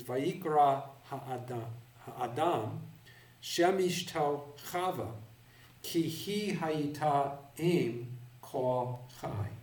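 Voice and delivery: slow, careful Hebrew reading. Shown as a demonstration. Teacher reads the Hebrew verse aloud to the class, slow and careful. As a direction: read each Hebrew word slowly and clearly. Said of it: "the text reads".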